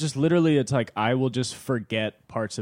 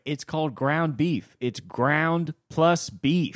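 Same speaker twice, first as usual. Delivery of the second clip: slow comic delivery; 5 semitones higher, much slower than usual. Retold or imitated. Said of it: "And he's like".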